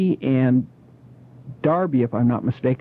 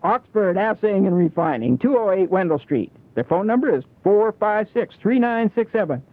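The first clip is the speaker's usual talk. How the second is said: ad read; 6 semitones higher than usual. Acted.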